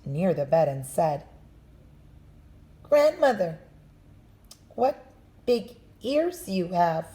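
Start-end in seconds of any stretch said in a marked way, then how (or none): 2.8-7.1 put-on lightly raised little girl voice; a hint of a wondering child; girl's voice. Girl questions the wolf.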